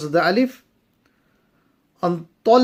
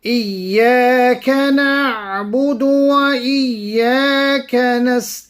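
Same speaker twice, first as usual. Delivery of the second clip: melodic tajweed recitation, a touch higher; Quran recitation; 5 semitones higher, 4 dB louder than usual. Acted. Teacher reciting the verses for students, melodic and measured. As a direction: chant the verses slowly and evenly. Recites full Surah Fatiha.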